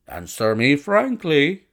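loud, businesslike gentleman caller; blunt visitor sizing up a young doctor; brusque visitor. Acted. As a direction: play a forthright man plunging into business. Narrator voices the visitor Blessington.

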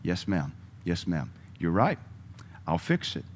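soft, submissive yes sir to the boss; meek yielding reply. Shown as a demonstration. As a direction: give in quietly, no fight. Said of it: "just say".

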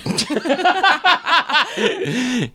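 big open laugh; loud laugh. Real, not acted. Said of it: "This guy has a Mongolian accent".

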